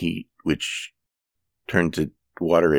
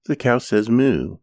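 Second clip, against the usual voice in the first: higher, singsong kiddie voice; reading to a toddler; 6 semitones higher, 3 dB louder than usual. Pretend. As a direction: tell it like a picture book. Children's book animal sounds.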